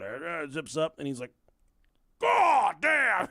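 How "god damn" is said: high, loud hollered exclamation; dad's shocked yell. Retold or imitated. Dad spots his son at the bare trough, shocked yell. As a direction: holler it in mock outrage, stretched out.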